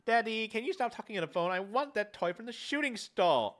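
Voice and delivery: a hint of a whiny little girl; child voice.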